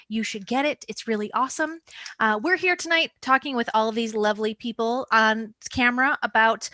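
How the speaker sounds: usual speaking voice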